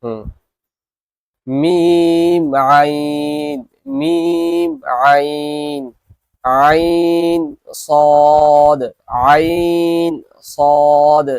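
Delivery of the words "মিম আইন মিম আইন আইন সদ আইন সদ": each letter name drawn out slowly and loud; letter drill. Shown as a demonstration. Teacher models letter pairs for students to repeat, drawn out. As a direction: sing out জিম and দাল long and clear. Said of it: "আমি দুবার পড়ব".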